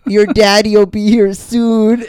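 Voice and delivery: high, slow, sugary consoling voice; mock comforting. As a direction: pat a fake-crying kid on the head. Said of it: "she goes".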